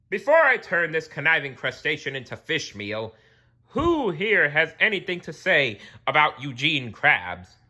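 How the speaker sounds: loud royal address to crowd. Acted. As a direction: address the court like a king.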